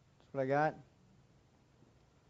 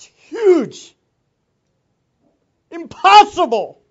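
rising to a loud preaching cry; booming preacher voice; 22 dB louder, much slower than usual. Real, not acted. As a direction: belt it out from the pulpit. Preacher building to climax.

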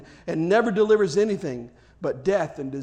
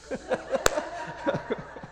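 laughing; 8 semitones higher, 6 dB quieter than usual. Real, not acted. Laughs at heckler.

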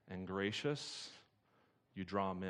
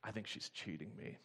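murmuring under breath; 2 semitones lower, 7 dB quieter than usual. Retold or imitated. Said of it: "murmuring under his breath".

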